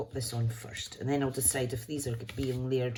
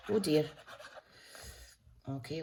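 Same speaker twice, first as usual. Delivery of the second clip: ordinary delivery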